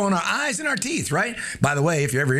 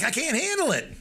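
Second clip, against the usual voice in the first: whiny self-doubt; 9 semitones higher, 4 dB quieter than usual. Pretend. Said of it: "catch yourself saying things like".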